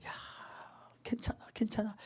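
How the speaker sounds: unvoiced whisper, hollow reassurance; whispered mock comfort. Pastor mocking empty comfort to a ruined friend, whispered. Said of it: "괜찮아집니까?".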